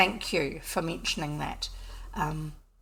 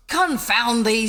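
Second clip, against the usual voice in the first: louder, slow exasperated oath; mild curse; 2 semitones higher, 8 dB louder, slower than usual. Acted. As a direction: curse the boggart in dismay. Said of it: "said the poor farmer".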